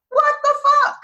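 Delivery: high, loud disbelief; shocked. Real, not acted. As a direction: blurt it out stunned. Reacting to the quoted apology.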